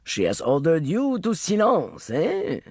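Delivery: put-on smooth, easy French accent; charming Frenchman greeting old friends, French accent; René's light French accent, unhurried. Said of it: "thick with a French accent".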